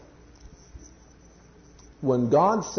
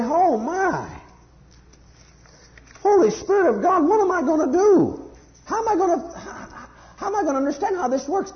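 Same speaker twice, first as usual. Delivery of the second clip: much higher, loud, desperate cry for help; overwhelmed pleading believer; 14 semitones higher, 3 dB louder than usual. Pretend. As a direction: plead to the Holy Spirit like you're at a loss.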